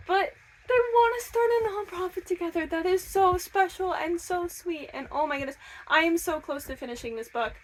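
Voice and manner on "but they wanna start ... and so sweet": high, far-from-casual delighted gush. Real, not acted. Reader returning to the couple's nonprofit plan, gushing. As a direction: melt over it, pitched up and easing down.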